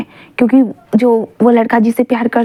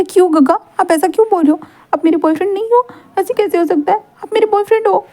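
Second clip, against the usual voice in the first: put-on higher, whiny hurt boyfriend plea; pleading Wing; 6 semitones higher than usual. Boy begging his boyfriend to take it back, rising whine. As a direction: beg him not to deny it, voice climbing up.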